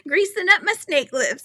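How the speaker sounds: high, loud put-on voice for a viewer's saying; quoting Granny D. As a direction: ham up the viewer's catchphrase with glee. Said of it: "how she says it".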